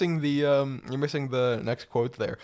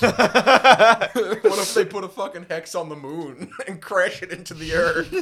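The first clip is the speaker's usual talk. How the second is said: laughing through the words, louder and higher; can barely get it out for laughing; 6 semitones higher, 7 dB louder, slower than usual. Real, not acted. Laughing at own joke.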